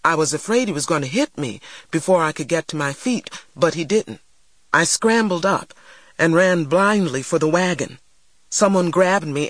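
ordinary delivery, nothing marked

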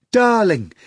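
high, loud delighted call; gleeful. A narrator's reading. Natasha answers her mistress, high gleeful call. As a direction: call out in giddy triumph. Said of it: "Natasha replied".

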